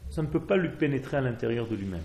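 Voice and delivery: normal talking voice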